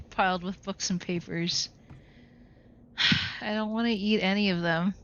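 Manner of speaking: ordinary delivery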